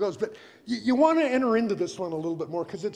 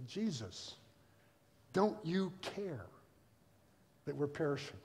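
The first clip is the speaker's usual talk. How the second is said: subdued question; 4 semitones lower, 10 dB quieter than usual. Retold or imitated. Reads disciples' question quietly.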